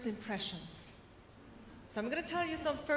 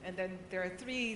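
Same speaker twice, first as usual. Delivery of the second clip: normal talking voice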